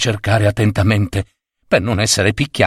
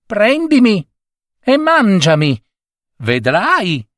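high piping cricket voice; 10 semitones higher than usual. Acted. Cricket warning the husband in a high voice. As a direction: pipe it up high like a tiny creature.

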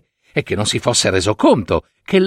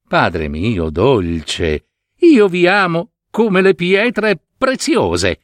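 slow then rising sweetly; a hint of a fawning middle daughter; flattering daughter; 7 semitones higher, slower than usual. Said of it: "lo rassicurò la figlia di mezzo".

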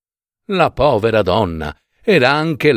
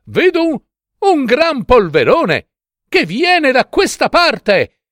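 excited sister; 13 semitones higher than usual. A narrator's reading. Sister sights dust from the tower, excited calling. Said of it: "rispondeva la sorella Anna".

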